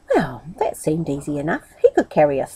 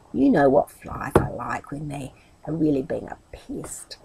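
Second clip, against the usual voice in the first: whispered aside; 5 semitones lower, 6 dB quieter than usual. A narrator's reading. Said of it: "whispered aside to listeners".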